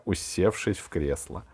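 plain reading voice

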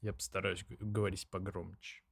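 ordinary talk

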